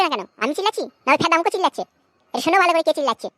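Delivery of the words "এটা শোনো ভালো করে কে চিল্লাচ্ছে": ordinary delivery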